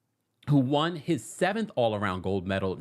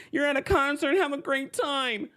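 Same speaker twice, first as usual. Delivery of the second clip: mocking commenters; 11 semitones higher than usual. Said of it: "everybody in the comment section, like".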